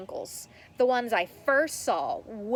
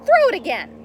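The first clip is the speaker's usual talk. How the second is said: loud, higher demand; yelling; 8 semitones higher, 10 dB louder than usual. Acted. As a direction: bark the order across the field. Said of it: "Ricky yells back".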